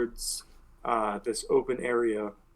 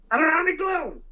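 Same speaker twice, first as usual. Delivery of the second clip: high squeaky exclaim; kobold complaint; 4 dB louder, faster than usual. Acted. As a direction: squeak it out indignantly. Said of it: "Kobold says".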